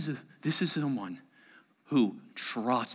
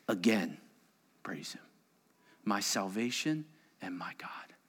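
soft, low whispered praise; hushed reading; 6 semitones lower, 7 dB quieter than usual. Acted. Preacher reading the psalm's promise in a whisper. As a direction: breathe out the promise.

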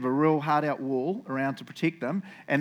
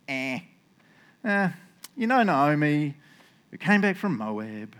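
slow, shrugging offhand delivery; who-cares nonchalance; slower than usual. Shown as a demonstration. Boaz casually tells the family redeemer about Naomi, nonchalant. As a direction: say it as if it hardly matters. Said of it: "I'll read it in the who really cares way".

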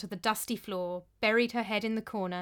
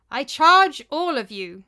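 loud, slow, raised-pitch charge; 4 semitones higher, 11 dB louder than usual. A narrator's reading. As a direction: proclaim it to the whole hall. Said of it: "he said in a loud voice".

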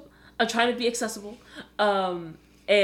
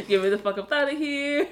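high, half-laughing whine; hammed-up begging to get out; mock desperate plea; 7 semitones higher than usual. Joining in the captive's complaints.

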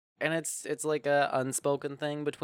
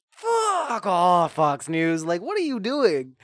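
high, loud exasperated yell; mock outrage; 8 semitones higher, 8 dB louder, much slower than usual. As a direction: yell at the news site in disbelief. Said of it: "I was like".

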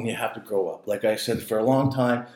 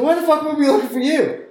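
mock tough biker; 4 dB louder, faster than usual. Bikers' retorted question.

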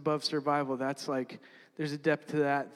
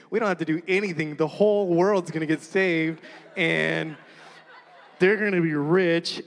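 louder, sarcastic amazement at their pages; mock awe; 4 dB louder than usual. Watching classmates read long dreams, sarcastic awe. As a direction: gush with mock wonder, half laughing. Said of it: "you're like".

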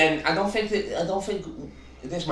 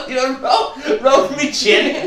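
laughing; 9 semitones higher, much slower than usual. Real, not acted. Laughing at a mimed move.

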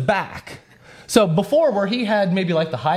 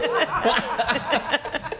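high, breathy burst of laughter; 8 semitones higher, 4 dB quieter than usual. Real, not acted. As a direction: laugh out in disbelief. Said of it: "I was cracking up laughing".

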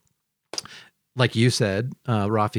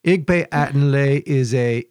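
higher, quick-fire Pig Latin gibberish; 3 semitones higher, 3 dB louder than usual. Shown as a demonstration. Pig Latin, showing the cohosts his secret childhood language. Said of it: "I know Pig Latin".

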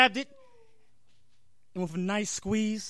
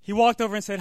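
ordinary talk

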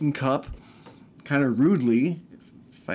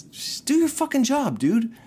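much higher, fed-up outburst; retold exasperated outburst; 12 semitones higher than usual. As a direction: blurt it out, at the end of your patience. Telling off the house staff.

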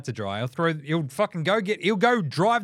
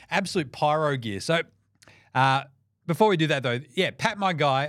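ordinary talk, nothing marked

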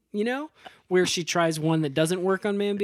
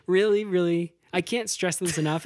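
ordinary delivery

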